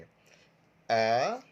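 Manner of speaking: loud, long अ for repeating; teacher's drawn-out, isolated अ; model sound. Shown as a demonstration. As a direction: hold अ out loudly on its own. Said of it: "मैं बोलता हूँ, आप मेरे साथ बोलेंगे".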